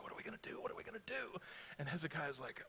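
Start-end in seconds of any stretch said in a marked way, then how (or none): 0.0-1.4 hushed worried murmur, much softer; anxious whispered fretting; a touch of mock panic; panicked people. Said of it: "the people are like".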